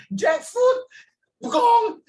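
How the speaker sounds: high, loud comic wail; hammed-up anguished howl; mock wailing shout. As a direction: cry out in fake despair. Comic cry at jackfruit.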